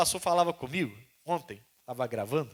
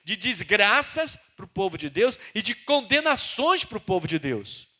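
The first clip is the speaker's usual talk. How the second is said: loud, raised preaching voice; full-force preaching; 8 semitones higher, 8 dB louder than usual. Acted. Preacher to the congregation, loud full-force preaching. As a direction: proclaim it to the church. Preacher proclaiming God's word.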